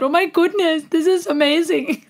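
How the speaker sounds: high, cooing awe; mock gushing fan. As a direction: coo over the singer. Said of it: "The German in me is coming out".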